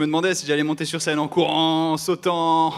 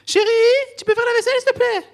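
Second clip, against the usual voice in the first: put-on girlfriend voice; 9 dB louder than usual.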